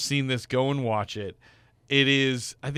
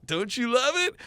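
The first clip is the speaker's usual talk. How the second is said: hopeful husband; 12 semitones higher than usual. Retold or imitated. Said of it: "And he's like".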